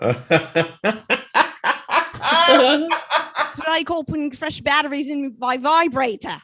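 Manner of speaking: hammed-up Cockney accent; a clumsy stab at Cockney; bad Cockney accent. Shown as a demonstration. Comic delivers the punchline in a bad Cockney accent. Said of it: "That was the worst Cockney accent I've ever done".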